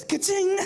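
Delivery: high, loud cash register call; ka-ching. Retold or imitated.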